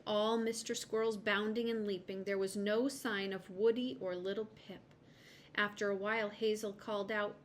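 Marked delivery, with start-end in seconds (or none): none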